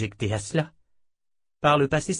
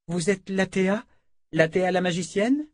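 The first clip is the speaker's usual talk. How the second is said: lightly voiced young woman, higher; a higher, hopeful questioning voice; 10 semitones higher, 4 dB louder than usual. A narrator's reading. Jensen to the sorceress at her door, questioning.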